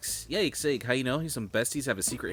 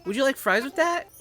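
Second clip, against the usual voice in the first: much higher, louder, rising offer; high loud fries line; 6 dB louder than usual. Pretend. Final greeting take recorded for the game. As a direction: belt it like an eager cashier. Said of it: "that's it".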